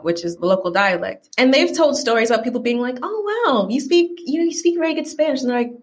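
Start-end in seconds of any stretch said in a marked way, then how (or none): 3.0-5.4 high-pitched imitation of an impressed stranger; mock amazement. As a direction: gush in mock surprise, pitched up. Mimics people amazed at fluent Spanish.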